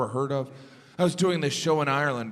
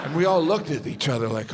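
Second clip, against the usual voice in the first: usual speaking voice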